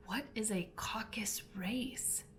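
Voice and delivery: unvoiced whispered question. A narrator's reading. Alice asks the dodo in a whisper. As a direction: breathe the question softly.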